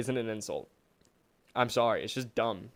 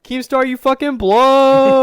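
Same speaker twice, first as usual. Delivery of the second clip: mock yell; 12 semitones higher, 14 dB louder, slower than usual. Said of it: "the big hitters from YouTube were like".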